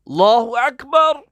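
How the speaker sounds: Allahu Akbar yelled at full force, high and loud; shouted takbir. Shown as a demonstration. Preacher mocking worshippers who shout at God, yelling. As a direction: bellow the takbir like a wrong example. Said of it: "Tak payah jerit-jerit".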